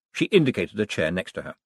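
ordinary delivery

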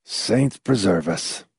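slow, soft pious murmur. Acted. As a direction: say it piously and softly. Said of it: "McFife said piously".

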